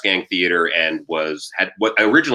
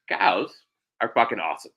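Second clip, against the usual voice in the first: ordinary talk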